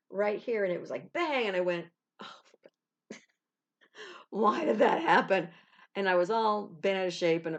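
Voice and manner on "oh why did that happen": laughing dismay. Retold or imitated. Car hits passenger side, dismay.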